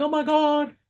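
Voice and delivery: high, squealing thrill; excited. Acted. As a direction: gush like a nervous beginner who just got her first deal. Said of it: "She's excited, she's nervous, she's like".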